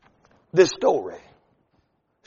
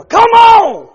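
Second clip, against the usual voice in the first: much higher, louder exasperated yell; frustrated shout; 8 dB louder than usual. Retold or imitated. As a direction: yell at a dead van. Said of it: "hit the steering wheel".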